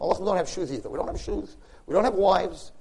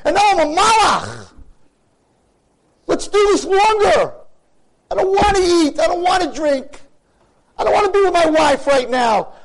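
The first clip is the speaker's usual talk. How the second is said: raised, higher, louder pleading prayer; impassioned preaching; 13 semitones higher, 9 dB louder than usual. Acted. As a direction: cry out your longing to God with full force. Ecstatic Yom Kippur yearning.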